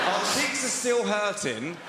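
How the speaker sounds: incredulous drawn-out replay; suggestive repeat. Retold or imitated. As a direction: stretch each word for the double meaning. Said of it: "this couple approached me".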